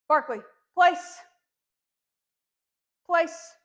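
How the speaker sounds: loud, slow command to a puppy; firm dog command. Real, not acted. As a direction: call the dog firmly to its bed.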